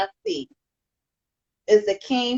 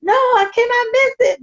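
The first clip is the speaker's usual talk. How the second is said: much higher and louder, an alarmed no; dramatic protest; 11 semitones higher, 4 dB louder than usual. Retold or imitated. Podcaster retelling his reaction to missing the Tuesday show, high alarmed protest. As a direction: cry out like you can't bear to miss the show. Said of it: "And I'm like".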